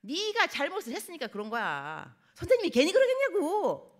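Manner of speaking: a mother blaming her son; sharp, climbing rebuke; scolding mother. Retold or imitated. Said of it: "엄마들 어떻게 말하냐면".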